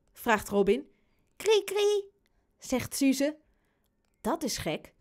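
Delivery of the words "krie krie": put-on high little baby squeak; baby babble. Baby Suze answers her big brother, high-pitched babble.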